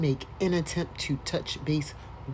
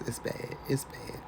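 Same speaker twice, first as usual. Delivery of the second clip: whispered; 2 semitones lower, 8 dB quieter than usual. Real, not acted. Reacting to letter in disbelief.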